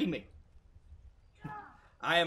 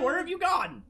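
high, louder doggy praise; pet talk; 8 semitones higher, 10 dB louder than usual. Pretend. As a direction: call the dog over, gushing. Calling and praising dog.

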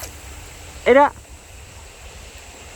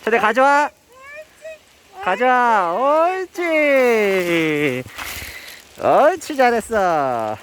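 high coaxing dog praise; talking to a dog; 9 semitones higher than usual. Real, not acted. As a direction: coax the dog, pitched way up.

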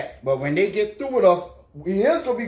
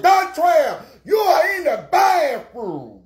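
retold shout; 6 semitones higher, slower than usual. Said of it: "I said".

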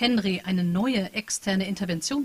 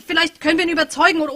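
hurried ideas; 8 semitones higher, 7 dB louder, faster than usual. Acted. Hurried brainstorming.

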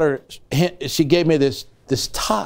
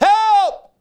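high, loud drowning man's cry; desperate cry for help; 4 dB louder than usual. Retold or imitated. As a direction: cry out like someone going under. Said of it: "then they cry out".

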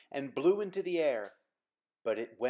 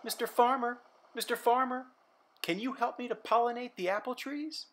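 higher call across a field; 10 semitones higher, faster than usual. Acted. Simon running to the farmer, calling out high. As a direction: call to someone far off. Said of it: "calling out".

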